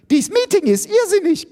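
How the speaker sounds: very high, loud screech; shrill yelping. A narrator's reading. Iris protesting the mad meeting, shrill yelping. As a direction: screech it in outrage. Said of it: "gilft Iris".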